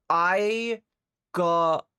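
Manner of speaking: I and got punched loud, word by word; proud, drawn-out emphasis; emphatic. Real, not acted. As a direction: hit each word hard. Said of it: "emphatic delivery".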